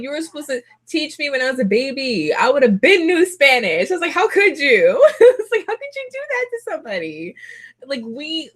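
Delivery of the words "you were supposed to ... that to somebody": high-pitched, hammed-up indignation; wounded mock protest, tipping into laughter; mock outrage. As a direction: scold mom in playful disbelief. Retold scolding of mom, half laughing.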